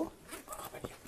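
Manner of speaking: hushed whispered aside; a tense whispered question. Real, not acted. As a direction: whisper so the spirit won't hear. Whispered aside about ghost.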